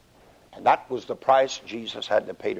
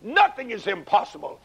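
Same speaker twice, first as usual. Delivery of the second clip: crying out; 11 semitones higher than usual. Acted. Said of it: "he cries out".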